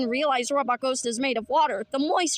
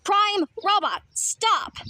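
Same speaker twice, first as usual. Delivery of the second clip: commanding call; 6 semitones higher, slower than usual. Acted. Calling out to halt the robot.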